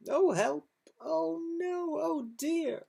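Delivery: high, mock-panicked cries; hammed-up dismay for the children; mock alarm. A narrator's reading. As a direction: gasp it out like a fretful hen. Eggs vanish, storyteller alarmed.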